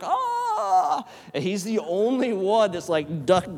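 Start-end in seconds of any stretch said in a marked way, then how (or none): 0.0-1.1 startled high scream of terror. Retold or imitated. Nazis shrieking as the Ark opens, startled scream. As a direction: shriek as faces melt. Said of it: "They're like".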